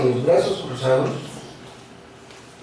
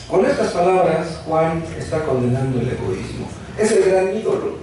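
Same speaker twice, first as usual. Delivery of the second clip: ordinary delivery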